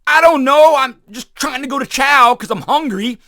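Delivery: high sheepish private mumbling excuses; clueless young soldier. Retold or imitated. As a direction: play the hungry private caught off guard. Clueless soldier answering the commander.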